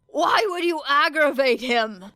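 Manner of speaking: slow, high, loud alarmed shout. A narrator's reading. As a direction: yell it in disbelief. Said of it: "shouted Shinzo".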